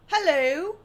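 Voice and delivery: a loud, hammed-up Australian "Hello"; mock Australian hello. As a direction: belt out "Hello" like a joke Australian. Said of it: "That's Australian".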